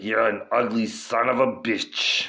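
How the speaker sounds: put-on action-hero voice, growled; Arnold Schwarzenegger impression. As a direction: sneer it at the beaten monster. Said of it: "And he's like".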